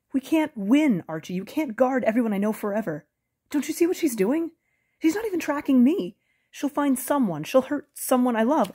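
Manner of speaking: high, loud, desperate pleading; panicked pleading. Acted. Bo pleading with Archie, loud and panicked. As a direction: beg him to see the danger, voice rising in panic.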